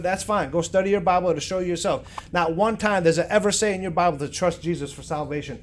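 ordinary delivery

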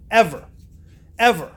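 each "Ever" loud and separate, after a pause; emphatic repetition. Real, not acted. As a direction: hammer "Ever" as a one-word blow. Hammers home "not one time.

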